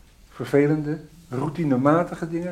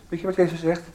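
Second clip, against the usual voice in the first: ordinary talk, nothing marked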